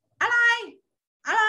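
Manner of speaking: high, loud, snappy what; bratty child snapping back; rude reply. Shown as a demonstration. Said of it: "ไม่เอานะคะ". (ตอบห้วนๆ เสียงสูงดังแบบไม่สุภาพ)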